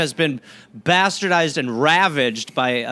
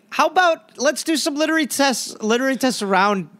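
high, loud, fast ranting; fired-up sarcastic rant; heated rant; 8 semitones higher, 3 dB louder than usual. Real, not acted. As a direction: push the idea in worked-up sarcasm.

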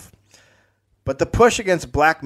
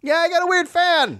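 mock high voice. Mock reply to heckle.

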